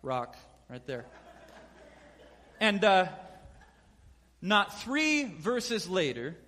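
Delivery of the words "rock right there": low, soft, smug boast; mock smug aside to the disciples; smug brag. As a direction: throw shade quietly. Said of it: "He's like".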